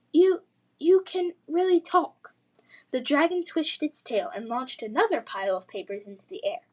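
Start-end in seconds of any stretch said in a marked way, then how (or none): 0.1-2.3 high, loud stammering disbelief; astonished. Acted. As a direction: gasp it out, stunned. Lily stunned by talking dragon.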